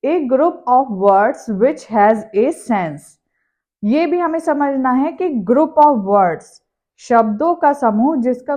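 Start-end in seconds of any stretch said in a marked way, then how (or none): 0.1-3.0 very slow, word by word, as if writing on the board; slow dictation. Shown as a demonstration. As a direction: spell it out slowly for the class. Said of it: "as I written there".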